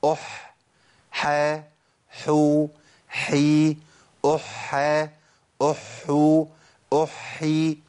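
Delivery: measured drill with a clear breathy h in "أحو"; seven forms of ha. Shown as a demonstration. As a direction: recite each syllable separately and held, "أح، حا، حو، حي". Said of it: "هقولهم ورا بعض".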